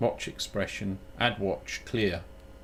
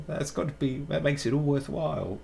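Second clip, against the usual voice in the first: ordinary delivery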